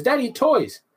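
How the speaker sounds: put-on high little-boy plea; imitating toddler. Father imitating his toddler son, high childish plea. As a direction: squeak it like a two-year-old asking. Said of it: "and he goes".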